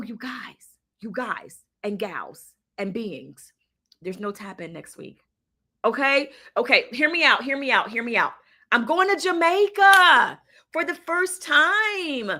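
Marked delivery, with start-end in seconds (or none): 0.0-5.2 hushed. Real, not acted. Host to viewers before an announcement, hushed. Hushed lead-up to breaking news. 8.7-12.3 high, drawn-out, thrilled delivery; excited announcement. Real, not acted. Host telling viewers her trip news, excited.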